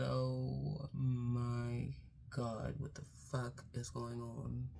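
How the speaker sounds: soft, drawn-out stunned murmur; shocked hush. Retold or imitated. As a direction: let it out in disbelief. Shocked seeing Hayes hurt.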